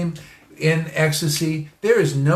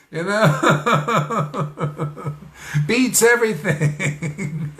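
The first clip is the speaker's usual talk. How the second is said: laughing; 4 semitones higher, much slower than usual. Real, not acted. Laughing at his own joke.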